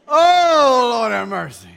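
loud, high exclamation; exasperated cry to heaven; exasperated groan. Retold or imitated. Exasperated reaction to wife.

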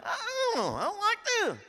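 high, tearful whine, hammed up; mock whining. Frustrated man complaining to his friend, mock whining.

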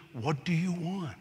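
quiet and unhurried, Jesus asking gently; soft, slow question. Acted. Jesus quietly asking the blind beggar, soft and slow. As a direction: let the question land softly.

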